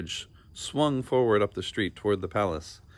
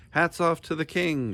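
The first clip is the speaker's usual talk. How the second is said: captain's shout; 8 semitones higher than usual. A narrator's reading. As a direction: bellow it to the whole crowd. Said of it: "shouted the captain".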